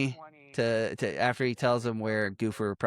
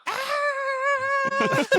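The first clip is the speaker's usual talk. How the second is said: sudden high squeal; high shriek; 5 dB louder than usual. Retold or imitated. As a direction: let out a startled shriek. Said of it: "she's just like".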